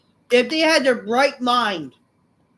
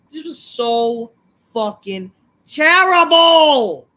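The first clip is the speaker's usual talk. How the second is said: angry shout; 8 dB louder, slower than usual. Real, not acted. Ranting about a bad show.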